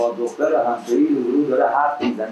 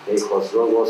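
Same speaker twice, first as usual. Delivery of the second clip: ordinary delivery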